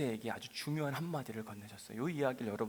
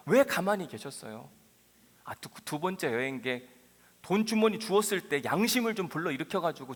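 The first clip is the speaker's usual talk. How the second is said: impassioned preaching; 6 semitones higher, 5 dB louder than usual. Acted. Preacher voices Moses' complaint.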